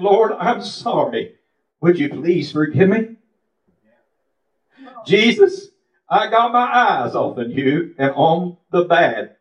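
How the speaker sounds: impassioned, slowed cry of repentance; pleading prayer. Retold or imitated. Re-enacts tearful prayer of repentance.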